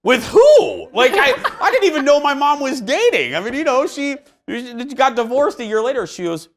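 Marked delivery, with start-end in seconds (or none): 0.0-0.9 high, loud, laughing "with who?"; shocked question. Retold or imitated. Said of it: "And I went". 0.9-6.1 laughing disbelief. Real, not acted. Reacting to mom dating news.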